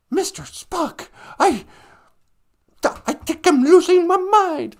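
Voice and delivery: mock melodramatic captain pleading; hammed-up Shatner impression. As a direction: ham up a dramatic starship captain. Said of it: "he was, like, so over the top".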